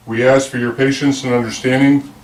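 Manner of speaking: usual speaking voice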